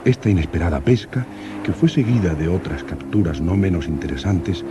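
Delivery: calm measured narration; old radio serial announcer. A narrator's reading. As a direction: narrate the log like vintage radio. Diary narration over music.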